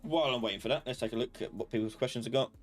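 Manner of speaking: normal talking voice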